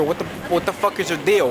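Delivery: normal talking voice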